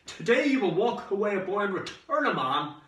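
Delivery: high, loud, grand pronouncement; yelled send-off. Acted. Dad saluting his son's departure, yelled. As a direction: boom it out like a sergeant major. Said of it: "he yelled".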